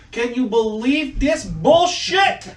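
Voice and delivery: put-on slow, high, exasperated old Italian guy. Old Italian barber griping to his friend, slow and high. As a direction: drag it out in disbelief. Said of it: "the old Italian guy goes".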